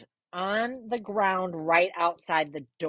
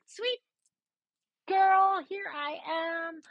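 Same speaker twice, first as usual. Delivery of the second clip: blissed-out greeting; 9 semitones higher than usual. Retold or imitated. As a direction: sing out a carefree hello. Her blissful reply, retold.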